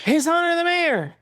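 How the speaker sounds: put-on stiff, high line reading like a child actor; bad child actor. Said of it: "Just a bad child actor".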